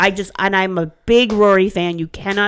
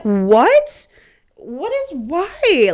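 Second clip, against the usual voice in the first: high-pitched baffled questioning; acting out total bafflement at the haters; incredulous; 10 semitones higher, 4 dB louder, much slower than usual. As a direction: squeak upward in disbelief. Baffled reply to Rory haters.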